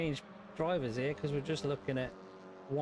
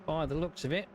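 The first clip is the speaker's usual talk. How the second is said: race commentary; 4 semitones higher than usual. Acted.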